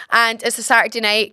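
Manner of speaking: ordinary talk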